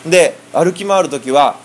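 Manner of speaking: usual speaking voice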